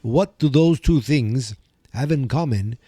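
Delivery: host welcoming listeners as a campy Transylvanian count; vampire accent. Pretend. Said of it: "with a very stereotypical European accent used for vampires".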